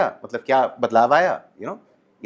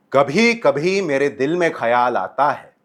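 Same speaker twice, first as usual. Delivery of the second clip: projected from the diaphragm, slower and louder; stage delivery; 4 dB louder, slower than usual. Shown as a demonstration. Coach showing a theatre voice for a big auditorium. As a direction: throw the line to the back row. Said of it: "लेकिन मैं समझो stage पे हूँ, तो बोलूँगा".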